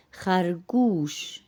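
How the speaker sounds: خرگوش with a long oo in the last syllable; Persian pronunciation. Shown as a demonstration. Teacher introduces the rabbit word to Urdu speakers, Persian pronunciation. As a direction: say خرگوش the Persian way, ending in "goosh". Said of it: "فارسی میں او کے آواز کے ساتھ".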